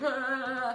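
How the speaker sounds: high drawn-out mock wail; panicked wail. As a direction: wail wordlessly like an alarmed kid. Panicked brother pointing at microwave.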